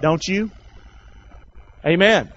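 usual speaking voice

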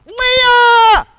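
high, loud, drawn-out cry; wake-up scream of shock; horrified shriek. Acted. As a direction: shriek the name in comic horror. Waking shock at finding Leah.

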